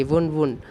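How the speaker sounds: "वुंड, वुंड" said with an oo sound; pronounce wound. Shown as a demonstration. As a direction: repeat "वुंड" twice, round the oo. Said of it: "second and third form इसकी same हो जाएंगी". ("वुंड" को दो बार ऊ के साथ बोलें)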